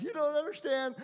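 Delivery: high-pitched imitation of a tearful plea; retold crying. Neighbor's son insisting God sent him, mock crying. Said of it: "he starts crying. He goes".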